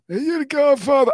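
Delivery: high, loud mock-Italian cry; hammed-up Italian gangster voice; put-on Italian accent. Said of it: "that's my only Italian, uh, accent".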